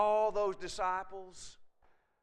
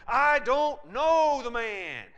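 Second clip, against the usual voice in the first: loud, high, drawn-out shout; swearing denial; 6 semitones higher, 11 dB louder, much slower than usual. Acted. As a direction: bellow the denial slowly, each word stretched. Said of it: "Call down curses and swore to them".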